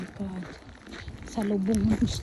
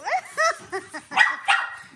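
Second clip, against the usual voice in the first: much higher burst of laughter; squealing laugh. Real, not acted. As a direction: squeal with delight. High-pitched laugh.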